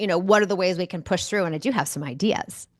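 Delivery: ordinary delivery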